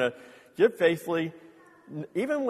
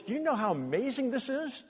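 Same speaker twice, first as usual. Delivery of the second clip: wife's amazement; 9 semitones higher than usual. Retold or imitated. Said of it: "she goes".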